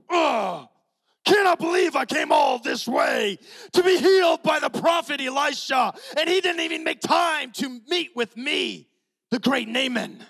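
high, loud furious shouting as Naaman; hammed-up outraged general yelling; angry rant. Naaman fuming over the prophet's snub, shouting high and loud.

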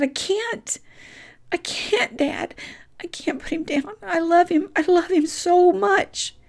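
high, breaking plea, last line slow; cling to the dog you love, begging; heartbroken pleading. Retold or imitated. Ten-year-old begs dad not to put dog down, high and broken.

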